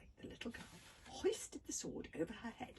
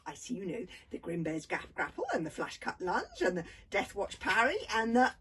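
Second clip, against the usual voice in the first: chattering; 11 dB louder than usual. A narrator's reading. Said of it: "carried on talking".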